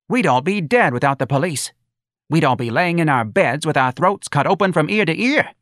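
high, loud declaiming mother; fervent mother. A narrator's reading. Mother warning the crowd they need police, high and fervent. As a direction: proclaim it high to everyone.

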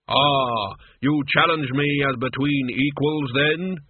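stately, intrigued Death. Acted. Death weighing Mort's challenge, lofty and intrigued.

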